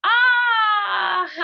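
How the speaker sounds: loud high ah then a laugh; bright ah sound breaking into laughter. Shown as a demonstration. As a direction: sing out ah, then burst out laughing. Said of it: "with a little ah sound".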